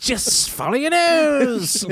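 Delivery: very high, slow sly leprechaun; drawn-out high trickster coaxing; high sneaky leprechaun luring Roger. Acted. Leprechaun voice continued.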